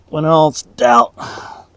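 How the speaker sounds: straining, grunting through the words; effort grunt. Real, not acted. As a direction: grunt and groan while hammering. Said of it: "Sorry for all the grunting and groaning".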